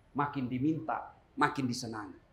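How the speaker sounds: a hushed repeat of makin disenangi; soft echo. Shown as a demonstration. Same phrase repeated softly.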